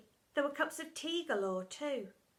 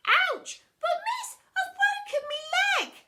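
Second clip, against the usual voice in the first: very high, loud mock pain; yelping child; 9 dB louder than usual. Pupil poked by stick, hammed-up yelp. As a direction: squeal like a hurt kid.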